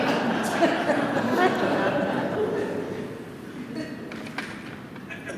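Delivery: crowd laughing together; audience laughter. Real, not acted. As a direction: room breaks into laughter.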